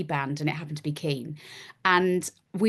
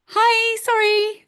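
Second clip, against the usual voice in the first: much higher and louder hi sorry; flustered apology; 7 dB louder, much slower than usual. Retold or imitated. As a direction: squeak it out, embarrassed. Bumping into Tarantino, flustered.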